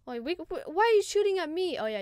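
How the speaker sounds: usual speaking voice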